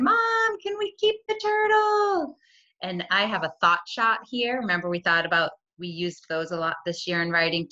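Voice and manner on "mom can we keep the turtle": put-on high, slow begging kid voice; pleading child. Teacher voicing her daughter asking to keep it, pleading. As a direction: beg like a little girl for a pet. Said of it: "when Kyle said".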